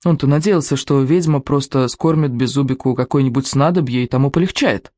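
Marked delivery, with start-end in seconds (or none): none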